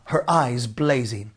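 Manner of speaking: plain reading voice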